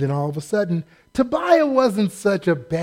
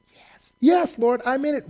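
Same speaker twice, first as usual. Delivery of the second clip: eager yes; 7 semitones higher than usual. Pretend. Eager believer answers Lord.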